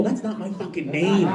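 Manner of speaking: normal talking voice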